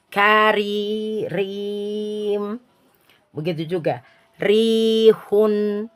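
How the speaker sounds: slow light r in rihun; r kept thin, tongue flat; careful slow thin r. Shown as a demonstration. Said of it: "Kalau dibaca tipis".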